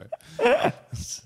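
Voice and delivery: very high giggle; high squealing laugh. Real, not acted. Laughing in put-on voice.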